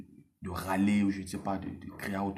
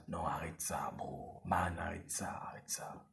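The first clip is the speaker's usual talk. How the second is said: softer, unvoiced whisper telling yourself to stop; whispered self-warning; 5 semitones lower, 9 dB quieter, slower than usual. Coaching the man to stop himself, whispered. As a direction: hush it out like a stern inner voice.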